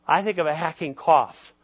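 ordinary delivery

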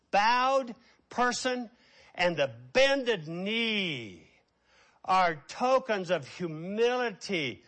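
slow, loud, emphatic preaching; forceful preaching. Acted. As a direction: drive each word home slowly from the pulpit.

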